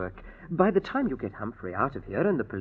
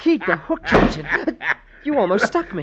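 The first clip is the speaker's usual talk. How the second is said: startled; 6 semitones higher, 6 dB louder than usual. Acted. Startled by the captain's hook.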